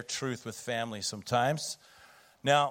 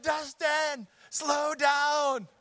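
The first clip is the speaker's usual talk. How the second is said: alarmed cry; much slower than usual. Retold or imitated. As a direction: yelp it out like a terrified passenger. Scared passenger yells at son.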